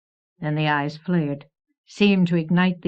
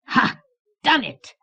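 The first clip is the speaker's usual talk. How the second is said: high triumphant shriek after a sharp "Ha"; shrieking creature; 10 semitones higher than usual. A narrator's reading. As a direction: shriek it like a gloating goblin. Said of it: "he shrieked again".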